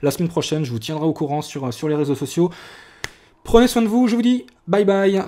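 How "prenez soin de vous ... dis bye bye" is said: bright sign-off. Real, not acted. Cheerful sign-off to viewers.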